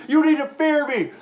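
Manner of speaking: high, shouted threat; mock menacing yell. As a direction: yell it like a tough guy. Driver screaming.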